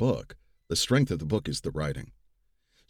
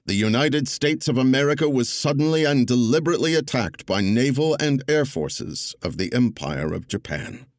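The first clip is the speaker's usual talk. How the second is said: louder, stately oratory, no impression; Roosevelt's cadence; 6 semitones higher, 7 dB louder than usual. Narrator reading Roosevelt's address to Congress, louder stately cadence. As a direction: give it presidential weight without mimicry. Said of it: "I embraced the cadence of it without trying to do the voice".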